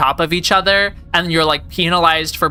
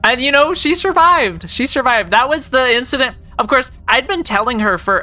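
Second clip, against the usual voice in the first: excited; 6 semitones higher than usual. Real, not acted. Excited retelling, pitch jumps.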